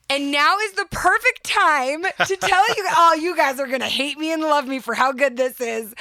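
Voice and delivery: high, loud giggling rush; excited. Real, not acted. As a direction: bubble over with glee. Excited sponsor reveal.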